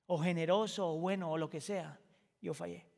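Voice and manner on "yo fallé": a hushed owning of failure; quiet confession. Acted.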